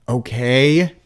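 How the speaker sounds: loud, high yell of okay; shouted okay, rising at the end. A narrator's reading. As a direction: yell it into the ground. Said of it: "I yelled at the dirt".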